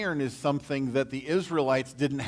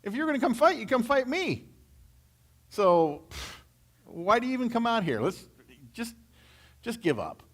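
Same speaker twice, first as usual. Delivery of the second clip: taunting Goliath; 9 semitones higher than usual. Acted. Goliath taunting the Israelite battle lines, high and sneering. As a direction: sneer at them like a giant bully. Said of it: "he says".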